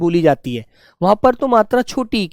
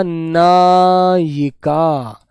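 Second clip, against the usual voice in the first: नायिका drawn out very slowly, syllable by syllable; slow dictation; 4 semitones lower, much slower than usual. Shown as a demonstration. Teacher dictates नायिका to the class, very slowly drawn out. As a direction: let every sound ring for the pupils.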